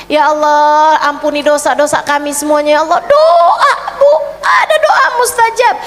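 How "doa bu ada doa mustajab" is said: a real excited, laughing call; laughing exclaim. Real, not acted. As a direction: burst out laughing as you urge them. Preacher urging the listeners.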